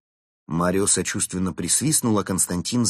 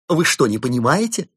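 high, loud outraged question; indignant; 8 semitones higher, 7 dB louder than usual. Acted. Mad inventor outraged that guests miss the point, loud. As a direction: burst out in indignation. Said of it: "возмутился сумасшедший самоучка".

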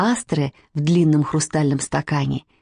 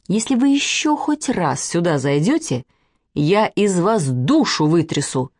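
usual speaking voice

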